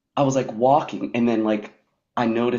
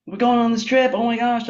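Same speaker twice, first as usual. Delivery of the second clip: much higher, much louder hammed-up delight; mock gushing excitement; 10 dB louder than usual. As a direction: parody her own inflated joy. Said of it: "you know, it's like".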